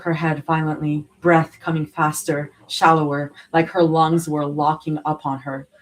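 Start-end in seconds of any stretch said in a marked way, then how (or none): none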